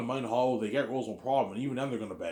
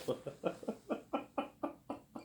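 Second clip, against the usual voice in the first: wheezy, voiceless high laugh; long laugh; 10 semitones higher, 12 dB quieter than usual. Real, not acted. Laughing at Keanu wanting the romance story, breathless laugh. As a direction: laugh helplessly until only breath comes out.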